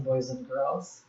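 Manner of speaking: normal talking voice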